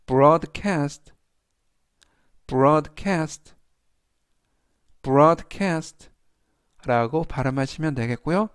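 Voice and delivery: broadcast with the weight on BROAD; teacher stressing the first syllable of broadcast. Shown as a demonstration. Teacher models word for class, stressing BROAD. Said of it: "앞부분에 있는 오의 강세가 있습니다".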